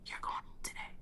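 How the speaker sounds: very soft, voiceless whisper. Retold or imitated. Voice heard on waking that morning, retold in a barely audible whisper. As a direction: imitate a hushed voice delivering a secret message. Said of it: "and I hear a whisper".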